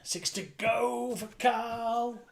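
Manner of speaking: sing-song bingo calling; bingo caller. Acted. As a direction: call the numbers out like a bingo hall. Calls bingo numbers live.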